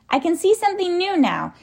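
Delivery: put-on high, urgent boy's call; excited cry. A narrator's reading. Tom calls down from the tree, excited cry. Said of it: "cried Tom".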